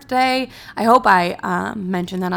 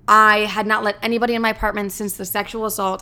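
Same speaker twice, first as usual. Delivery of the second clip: usual speaking voice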